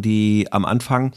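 usual speaking voice

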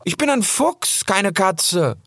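put-on high, indignant young fox voice; offended, correcting him sharply; high-pitched fox calls out indignantly. A narrator's reading. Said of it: "rief Rix entrüstet".

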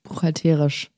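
low echo of Buchhalterisch as buchhal-TÉÉ-risch; shifted stress. Shown as a demonstration. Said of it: "auf einer anderen Silbe".

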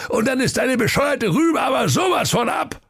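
hohe, wütende Königsstimme; drohender König. Acted. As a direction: gierig und drohend befehlen. König droht mit Henker. (drohender König, hohe Stimme)

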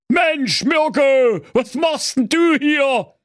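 high, slow surprised shout; stunned to find the sexton in the wardrobe; astonished. Acted. Husband finds sexton in the wardrobe, astonished shout. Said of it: "fragte der Werner ganz erstaunt".

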